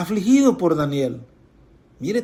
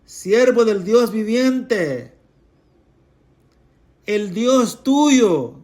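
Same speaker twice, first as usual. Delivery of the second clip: higher, slow mournful call; sad calling out; 5 semitones higher, much slower than usual. Acted. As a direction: call down into the den, grieving. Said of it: "le grita". (llamado lento, agudo y triste)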